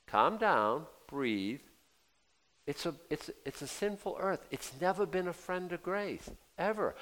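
normal talking voice

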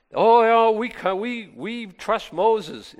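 high, loud opening, drawn-out mock assurance; mock protest. Preacher mimics people claiming they trust Moses, mock earnest protest. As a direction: overplay a hollow show of agreement.

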